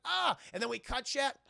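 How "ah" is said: mock cry of pain. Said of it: "And he's like".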